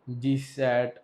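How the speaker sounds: GSAT stretched slow, each syllable held; slow teacher emphasis on GSAT; drawn-out name. Shown as a demonstration. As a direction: spell out GSAT slowly for the class. Teacher repeats satellite name.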